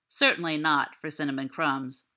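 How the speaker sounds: usual speaking voice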